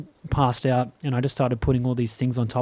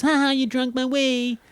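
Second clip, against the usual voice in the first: put-on high, gleeful child taunt; mock taunt; 12 semitones higher than usual. Older brother taunting his little brother, teasing. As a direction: gloat like a bratty brother.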